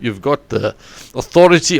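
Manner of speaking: ordinary talk, nothing marked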